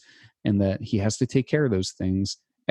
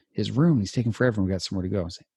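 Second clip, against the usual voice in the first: usual speaking voice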